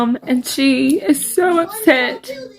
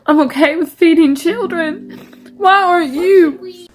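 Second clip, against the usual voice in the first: angry crying; 4 semitones higher than usual. Real, not acted. Working mother scolding viewers, tearful and angry. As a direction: voice breaking higher, tearful challenge. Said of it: "That's why I'm crying".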